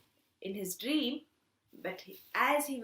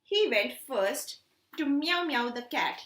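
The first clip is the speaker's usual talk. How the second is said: ordinary delivery, nothing marked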